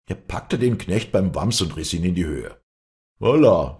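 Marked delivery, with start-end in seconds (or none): 3.2-3.8 loud, high startling yell; shouting. Acted. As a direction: yell right into his ear. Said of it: "schrie er ihm ins Ohr". (ins Ohr geschrien)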